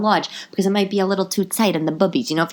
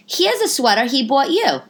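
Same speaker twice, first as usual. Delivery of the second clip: lightly voiced, higher-pitched saleswoman; saleswoman's eager reveal; 9 semitones higher, 3 dB louder than usual. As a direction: announce the find in a raised voice. Saleswoman breaking news to customer.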